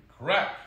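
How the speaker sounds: sudden, high, very loud crack; loud snapping crack. A narrator's reading. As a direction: punch out the snap. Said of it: "a loud snapping sound".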